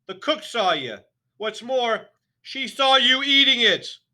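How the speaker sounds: furious headmistress; barked. A narrator's reading. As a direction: bark the warning. Trunchbull continues shouting.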